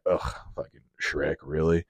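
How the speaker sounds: low, soft, fed-up grumble; weary scoff at Shrek; grumpy dad. Retold or imitated. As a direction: groan it like a parent dragged to a kids' movie. Grumpy parent hating animated movies.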